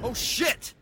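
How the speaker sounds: high startled yelp; startled shout. Acted. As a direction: jolt awake in shock. Wakes up suddenly.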